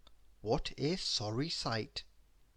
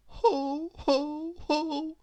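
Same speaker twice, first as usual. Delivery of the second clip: high, hollow ho ho; a mournful failed chuckle; mournful laugh; 12 semitones higher, much slower than usual. A narrator's reading. Miss Giggles tries once more, mournful. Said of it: "she added mournfully".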